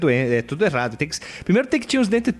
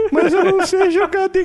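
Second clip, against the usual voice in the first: put-on much higher, slow, squeaky child's whine with a laugh; thin whiny kid voice; 3 dB louder, slower than usual. Short kid told he's goalie, answering in a thin whiny voice. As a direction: play your irritating little kid self reluctantly agreeing. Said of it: "Eu tinha uma vozinha fina, chata, irritante".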